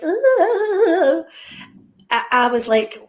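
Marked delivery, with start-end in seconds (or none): 0.0-1.2 high, loud wavering "ooh"; exaggerated high vocal wobble; mock singing warm-up. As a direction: imitate a cringeworthy singer warming up. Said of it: "when she was starting to, like".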